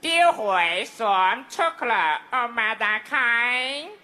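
lingering mock Thai. Shown as a demonstration. Comic giving the Thai version of the battle, lingering mock Thai. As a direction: croon the tale in lilting fake Thai. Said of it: "泰语这个特点是缠绵".

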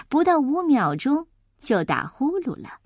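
usual speaking voice

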